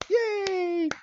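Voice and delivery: tiny, very high squeal; mock cheering. Florinda claps for the hero, high mock cheering. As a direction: squeak a delighted little cheer, softly on the name. Imitating her clapping and cheering.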